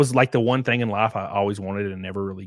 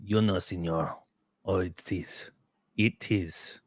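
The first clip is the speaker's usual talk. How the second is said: mock Mexican accent; 3 semitones lower, 6 dB quieter, slower than usual. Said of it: "Enrique Vasquez says".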